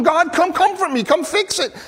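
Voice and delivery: urgent high-pitched acted plea; pleading cry to God. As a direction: beg God to come fix it. Psalmist begging God for help.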